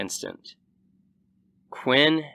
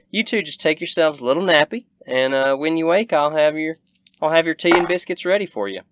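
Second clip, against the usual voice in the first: high, quick, louder country hostess; motherly frontier host, brisk and pitched up; Southern rancher woman, hospitable; 6 semitones higher, 5 dB louder, faster than usual. Acted. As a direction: fuss over the guests in a raised Southern voice.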